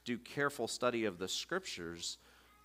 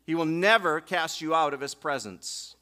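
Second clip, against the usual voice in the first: normal talking voice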